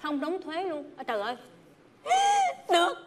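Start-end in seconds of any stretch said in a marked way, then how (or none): 2.0-2.6 sharp gasp. Real, not acted. Shocked by two hundred dollars.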